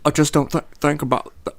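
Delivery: stammering impersonation in a put-on accent; Eddie Redmayne. Imitating the film's hero for friends, stammering. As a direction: break off and restart mid-word, awkwardly. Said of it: "like, stammering and".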